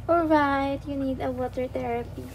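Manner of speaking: ordinary talk, nothing marked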